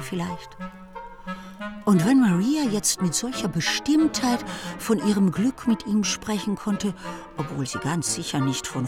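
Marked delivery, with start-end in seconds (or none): none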